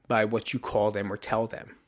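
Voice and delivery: normal talking voice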